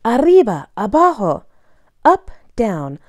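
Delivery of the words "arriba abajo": ordinary delivery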